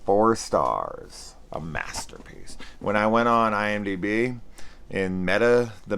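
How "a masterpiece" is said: whispered, much lower and softer; sarcastic whisper. Acted. Reviewer whispering a mock verdict on the film, sarcastic hush. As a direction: breathe it out like a secret joke.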